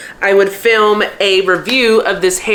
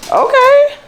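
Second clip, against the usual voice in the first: surprised; 4 dB louder than usual. Real, not acted. Dog surprises her.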